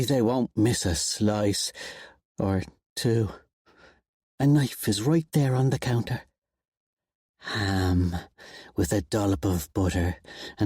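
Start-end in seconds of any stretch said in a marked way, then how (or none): none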